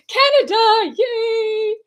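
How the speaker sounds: high, loud celebratory call; excited cheer. Real, not acted. Host cheering where live viewers join from, excited. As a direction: cheer it out high and bright with delight.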